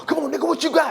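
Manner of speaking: high-pitched imitation of a fight challenge; put-on squaring-up call; mock challenge.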